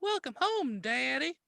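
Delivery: put-on high sweet girlish greeting; little girl voice. Daughter greets her father coming home, high little-girl voice. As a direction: play the sweet eleven-year-old.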